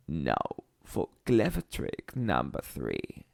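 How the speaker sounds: sly crocodile. A narrator's reading. Crocodile announcing his next trick, scheming. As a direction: plot it with low cunning. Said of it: "said the enormous".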